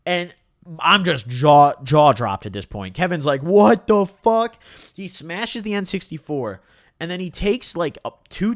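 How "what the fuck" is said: high, loud, drawn-out what the fuck; imitating a friend's slow, shocked yell; stunned shout. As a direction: blurt it out in disbelief, slowly. Kevin reacting to the smash.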